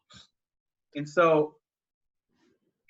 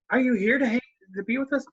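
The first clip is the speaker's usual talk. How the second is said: put-on high, childlike question; a child hoping dad stays; hopeful little girl; 8 semitones higher, faster than usual. Daughter asks father to stay, high childlike voice.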